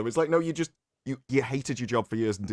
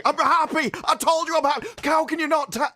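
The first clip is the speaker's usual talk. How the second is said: high, loud mock scream of insistence; imitated screaming; 14 semitones higher, 5 dB louder than usual. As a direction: scream it hysterically, mimicking someone protesting too much. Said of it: "he's just there screaming".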